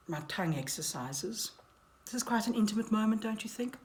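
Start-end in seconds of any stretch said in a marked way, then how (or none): none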